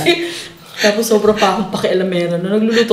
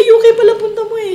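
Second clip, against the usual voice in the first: mock teasing, much higher pitch; teasing friends; 13 semitones higher, 4 dB louder than usual. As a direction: play the friends poking fun, pitched way up. Imitating friends' teasing reaction. (panggagaya sa kaibigang nanunukso, mataas ang boses)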